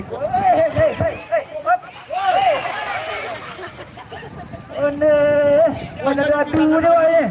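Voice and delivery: loud, high exclaiming at the game; excited outburst. Real, not acted. Gamer yelling at the screen in frustration, loud and high.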